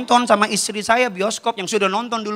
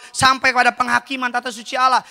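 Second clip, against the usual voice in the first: normal talking voice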